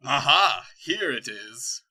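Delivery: loud triumphant cry; triumphant villain. Acted. Dark lord finds the vial, loud and triumphant. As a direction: shout it as you find the prize.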